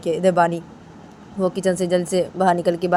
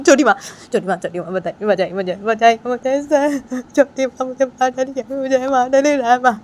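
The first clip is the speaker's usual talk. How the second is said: tearful pleading; 6 semitones higher, 3 dB louder than usual. Acted. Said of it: "तो बानी वजह से बोलती है". (धीमी, डरी हुई रोती गुहार)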